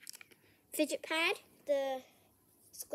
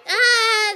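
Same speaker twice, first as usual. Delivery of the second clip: scared yell; 7 semitones higher, 16 dB louder than usual. Real, not acted. Loud scared cry.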